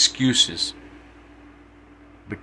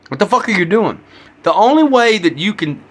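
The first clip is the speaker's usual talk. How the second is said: real frustration boiling over into a shout; exasperated shout; 8 semitones higher than usual. Real, not acted. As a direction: blow up at everyone listening. Escalating rant at audience.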